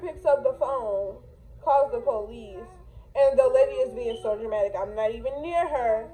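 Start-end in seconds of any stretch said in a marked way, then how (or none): none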